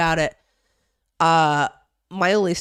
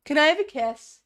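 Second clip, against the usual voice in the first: high cooing pet talk; 9 semitones higher than usual. Real, not acted. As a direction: ask the dog sweetly in a high voice. Asking dog for kiss.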